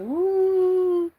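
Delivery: high, loud cheer; excited whoop. Real, not acted. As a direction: let out a happy whoop. Cheers high after drawing.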